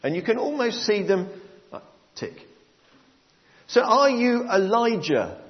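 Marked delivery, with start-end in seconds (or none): 3.7-5.4 questioning priests. Retold or imitated. Priests check John's authority, high insistent questioning. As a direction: press the question like an interrogator.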